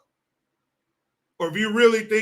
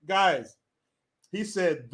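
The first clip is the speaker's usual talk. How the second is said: ordinary talk, nothing marked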